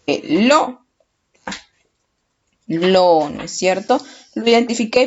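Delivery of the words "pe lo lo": "Pe, LO" with the second syllable pushed up, then "Lo" alone; stress on LO. Shown as a demonstration. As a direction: hit "lo" harder than "Pe", then repeat it drawn out. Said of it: "la que tiene la mayor fuerza de voz".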